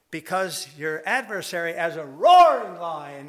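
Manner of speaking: animated preacher roars the line at the conference. Retold or imitated. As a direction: boom the verse out with drama. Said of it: "I'm being a little animated".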